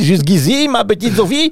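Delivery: high-pitched hammed-up German accent. Father speaking to little Sophie in German, hammed-up German accent. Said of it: "je prends l'accent allemand".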